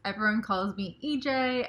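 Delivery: ordinary talk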